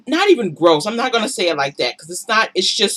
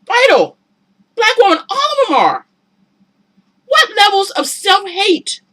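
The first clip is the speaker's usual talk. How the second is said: much higher, louder anguished shout; anguished outcry; 12 semitones higher, 5 dB louder than usual. Real, not acted. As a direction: plead with the whole community. Passionate rant at women.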